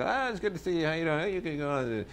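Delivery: put-on breezy old doorman waving a star in; a hint of a friendly old manager; old manager's greeting. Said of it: "he'd be like".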